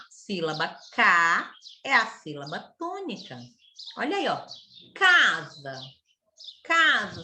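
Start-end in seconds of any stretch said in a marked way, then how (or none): 4.9-7.2 "Casa" held open on a long CA; stressed, stretched CA in "Casa"; lingering stressed syllable. Shown as a demonstration. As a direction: linger on the first vowel of "Casa", then close on sa. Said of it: "demorei de boca aberta".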